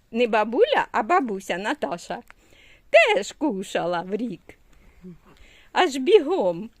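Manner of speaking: ordinary talk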